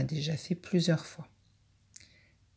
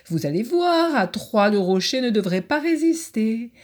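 confident elk; 5 semitones higher, 5 dB louder than usual. Acted. Elk voiced in story.

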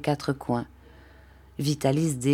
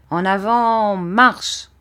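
loud, raised drill order; marching command; 5 semitones higher, 5 dB louder, much slower than usual. A narrator's reading. Vitalis orders Rémi to set off, commanding. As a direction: call out the order to move off.

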